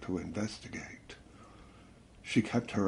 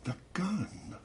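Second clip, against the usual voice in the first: high, soft startled echo; hushed realization; 10 semitones higher, 7 dB quieter than usual. A narrator's reading. She turns round as it dawns on her, hushed alarm. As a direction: softly, pitch leaping up on the realization.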